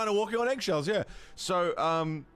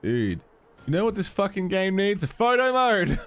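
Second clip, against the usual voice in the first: loud excited exclamation; 2 semitones lower, 7 dB louder than usual. Real, not acted. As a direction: burst out with it. Streamer exclaims what game needs.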